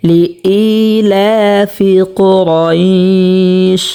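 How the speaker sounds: very slow, drawn-out tilawat chant; slow melodic Quranic recitation. Shown as a demonstration. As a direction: recite each word with long held vowels. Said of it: "এক আলিফ পরিমাণ টানিয়া পড়ব".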